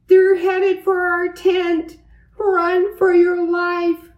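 loud, high-pitched alarm; panicked shout. A narrator's reading. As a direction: shout a frantic warning to flee. Boys flee supposed aliens.

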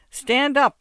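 a loud, high bark of a command; yelled. Acted. Muzhik yelling at the beggar to stand up. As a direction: yell it down at the beggar. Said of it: "yelled the muzhik".

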